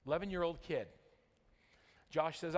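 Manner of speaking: ordinary talk, nothing marked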